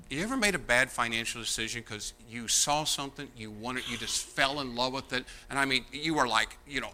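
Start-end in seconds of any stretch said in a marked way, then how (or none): none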